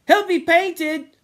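high, loud, drawn-out timid worry; timid warning in a very high voice. A narrator's reading. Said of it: "said Sam timidly".

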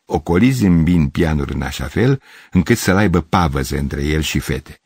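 plain reading voice

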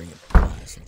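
a hissed aside he shouldn't hear; muttered insult. Acted. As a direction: whisper the insult under the breath. Muttered insult after pizza guy.

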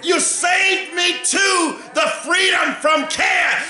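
preacher climbing into full fervour; preaching at full force. Real, not acted. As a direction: build the sermon to a ringing shout.

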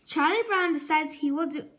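plain reading voice

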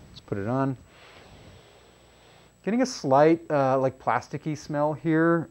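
muffled behind respirator mask; speaking with mouth covered; through a face mask. Real, not acted. Seen 0: Respirator mask covers mouth and nose while speaking; removed by 18.9.

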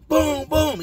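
high punchy boom boom imitation; door kicks. Inmate imitating kicking his cell door. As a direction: mimic heavy kicks on metal.

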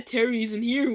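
normal talking voice

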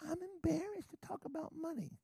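high, put-on voice of a timid believer; mock embarrassed believer. Preacher mimicking a believer embarrassed about money. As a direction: squeak it like a shy churchgoer.

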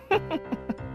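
high, soft snickering laugh; scoffing chuckle. Acted. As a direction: chuckle in derision. Creditor scoffs at faithful women.

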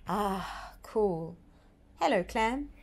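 ordinary delivery

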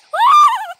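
high, loud cackling laugh; a real laugh that jumps high and loud; loud shrieking laugh. Real, not acted. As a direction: cackle at your own joke. Laughs after insulting a character.

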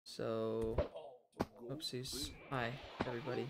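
normal talking voice

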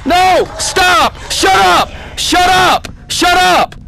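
high loud shout of shut up; terrified player shouting at the robot; frightened yelling. Real, not acted. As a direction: yell it at the thing scaring you. Yelling at the animatronic.

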